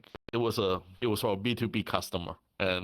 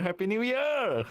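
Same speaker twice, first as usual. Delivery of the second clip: excited cheer; 13 semitones higher than usual. Acted. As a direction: cheer it out high. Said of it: "building repeated greeting".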